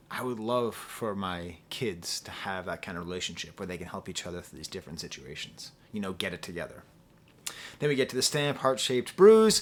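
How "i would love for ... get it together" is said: soft hushed wish. Real, not acted. Tender wish for her kids.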